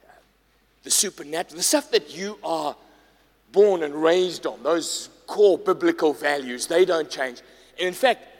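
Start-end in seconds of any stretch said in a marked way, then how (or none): none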